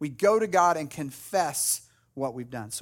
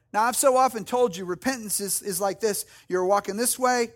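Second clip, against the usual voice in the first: ordinary delivery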